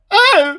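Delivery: sudden high loud yelp of pain; startled cry. Acted. Diarist hit by a catapulted frying pan, startled yelp. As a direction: cry out as the pan hits you.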